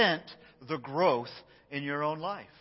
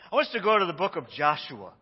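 ordinary delivery

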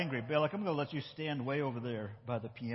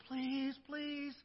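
put-on higher, soft childish begging; pleading please; 9 semitones higher, 7 dB quieter than usual. Shown as a demonstration. Teacher showing kids how to beg, soft high whine. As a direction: whine it like asking mom.

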